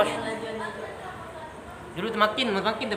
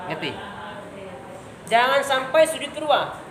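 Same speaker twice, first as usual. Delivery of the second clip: normal talking voice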